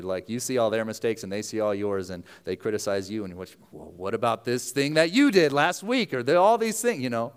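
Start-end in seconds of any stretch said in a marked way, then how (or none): 3.7-7.0 much higher, louder mock comeback; defensive retort. Criticized worker answers close coworker, defensive retort. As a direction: throw the blame back, pitch climbing.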